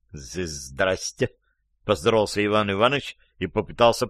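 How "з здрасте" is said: soft, low, halting hello; робкое заикание. A narrator's reading. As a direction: stammer the greeting slowly and sheepishly. Застигнутый здоровается, заикаясь. (робко, с запинкой)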